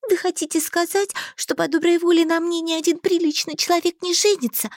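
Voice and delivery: put-on high, hurt naive girl; young girl voice. A narrator's reading. Heroine, high.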